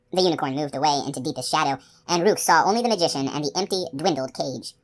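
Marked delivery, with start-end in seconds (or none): none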